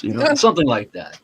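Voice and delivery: ordinary talk, nothing marked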